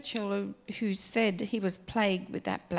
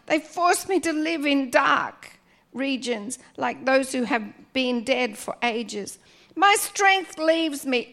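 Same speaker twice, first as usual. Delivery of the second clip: raised, pleading psalm reading; fervent scripture reading; 3 semitones higher, 5 dB louder than usual. Acted. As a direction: pray it aloud with fervour.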